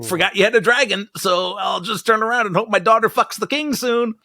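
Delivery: hammed-up oblivious plotter; mock dim Hightower. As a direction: shrug it off like a fool. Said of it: "He certainly wouldn't have been like".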